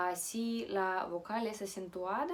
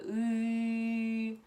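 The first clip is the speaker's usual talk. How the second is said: long, higher, steady drone on ы; mantra-like ы hum; 4 semitones higher, 5 dB louder than usual. Shown as a demonstration. Teacher demonstrates practising at the mirror, mantra-like ы drone. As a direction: chant a sustained ы like a mantra. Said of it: "Como una mantra".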